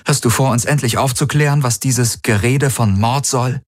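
zügig, etwas höher, kühl fordernd; kühl eine Erklärung verlangen. A narrator's reading. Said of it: "fragte sie kühl". (kühl und fordernd)

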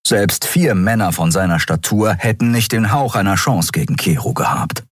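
ordinary delivery

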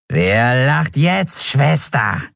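slow, high mocking taunt; creepy triumphant jeer; gloating skeleton. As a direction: savor the victory, drawn out. Skeleton gloats at captured Endriel.

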